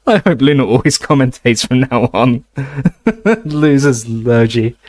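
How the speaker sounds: can barely hold back laughing; shaking with laughter. A narrator's reading. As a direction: laugh spilling through the words. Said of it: "his voice now shaking with laughter".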